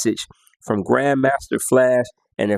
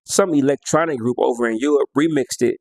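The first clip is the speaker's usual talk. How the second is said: ordinary talk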